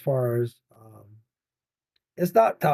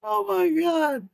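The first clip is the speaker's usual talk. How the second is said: high, slow amazed gasp; drawn-out delighted disbelief; surprised; 12 semitones higher than usual. Real, not acted. Surprised at viewer comment.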